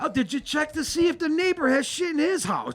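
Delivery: mock the cop's lazy police work; sarcastic mockery. Mocking the officer about the neighbor's claim, high sarcastic sneer.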